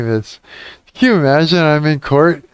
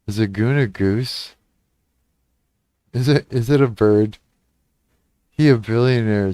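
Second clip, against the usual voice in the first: ordinary talk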